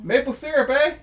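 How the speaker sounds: mock Canadian. Repeats the Canadian joke.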